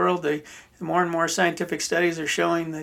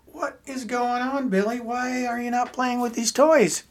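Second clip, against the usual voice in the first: slow, higher-pitched mock parental questioning; puzzled parents; 7 semitones higher than usual. Parents finding their son crying among the toys, puzzled. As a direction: voice bewildered parents, drawing the question out high.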